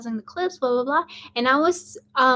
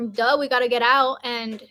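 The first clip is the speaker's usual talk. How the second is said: usual speaking voice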